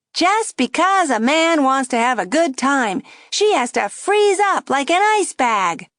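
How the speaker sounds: high, loud jeering puppet. A narrator's reading. Dummy jeering at the girl for nearby diners. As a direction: jeer for the audience. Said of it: "raised his voice".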